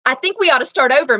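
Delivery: ordinary delivery, nothing marked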